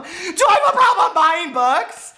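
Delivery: high, loud, slow mock panic; mock alarm. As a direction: gasp at your own habit, hammed-up. Self-mocking about book buying.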